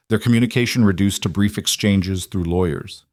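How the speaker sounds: usual speaking voice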